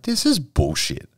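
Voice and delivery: high, slow scoff of disbelief; scoffing at the boss's excuse, slow and high; retold disgust. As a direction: drag it out in disgust. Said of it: "And I was like".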